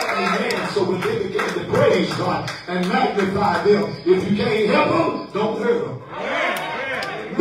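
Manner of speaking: urgent sermon delivery in punchy phrases; rally the congregation line by line; rousing preaching. Acted.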